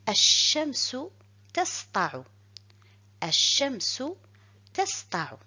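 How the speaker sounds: Ash-shamsu tasta'u read with the shin doubled, no L; Arabic reading. Shown as a demonstration. Teacher reads the example sentence for pupils. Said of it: "Et je lis".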